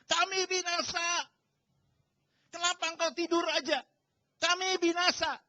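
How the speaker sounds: high, loud mock cry of doom; panicked shouting. Disciples waking Jesus in the storm, panicked shouting. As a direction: act out terrified disciples yelling for help. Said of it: "kenapa mereka teriak-teriak".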